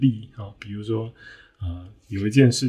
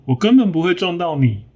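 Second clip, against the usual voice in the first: higher, whiny voice piling up excuses; excuse-making hippo; 6 semitones higher than usual. Acted. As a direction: rattle off excuses defensively. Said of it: "这个河马说".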